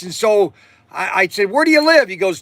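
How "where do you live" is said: eager question. Retold or imitated. Eager buyer asking seller's location.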